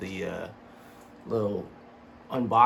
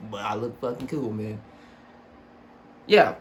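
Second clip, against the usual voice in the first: usual speaking voice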